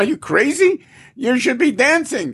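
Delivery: high-pitched retold protest; mock incredulous retort. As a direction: imitate an incredulous, upbeat objection. Retold astonished rebuke to friend.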